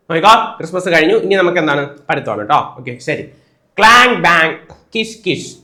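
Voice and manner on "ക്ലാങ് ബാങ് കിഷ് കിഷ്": imitated workshop noises. Shown as a demonstration. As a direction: sound out metal clangs from a workshop. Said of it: "ഇതൊക്കെ ഓരോ sound ആണ്". (പണിശാലയിലെ ശബ്ദങ്ങൾ അനുകരിച്ച്)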